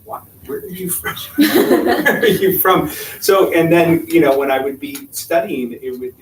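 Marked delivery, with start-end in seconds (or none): 0.0-2.9 laughing professor. Retold or imitated. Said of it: "my Spanish professor was like".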